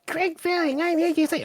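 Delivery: very high mocking hero voice; mocking blah blah. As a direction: mimic a pompous hero, sneering.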